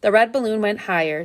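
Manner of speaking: ordinary delivery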